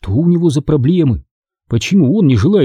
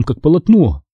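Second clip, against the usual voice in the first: ordinary delivery, nothing marked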